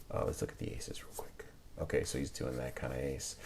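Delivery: unvoiced whisper to himself; soft murmured whisper. Real, not acted. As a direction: whisper as if not to disturb. Quiet aside while inspecting aces.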